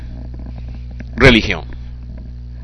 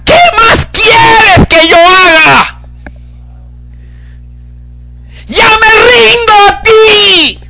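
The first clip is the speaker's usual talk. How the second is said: desperate shout; much slower than usual. Acted. Boy in a sinking boat cries to God, shouting desperately. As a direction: cry out like a boy sinking in the sea.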